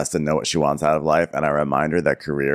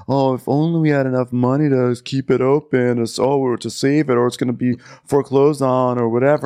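high, pleading mock-drama voice, pitched up; mock dramatic homeowner; 6 semitones higher, 4 dB louder than usual. Mocking Hallmark heroine begging to save her house. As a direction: ham up the desperate hotel owner. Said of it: "They're like".

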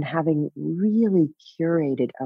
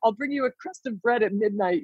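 higher, mocking cruel keeper; a light, hammed-up prison guard; mock jailer; 6 semitones higher than usual. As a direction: sneer like a cartoon jailer. Mocking her own jailer self.

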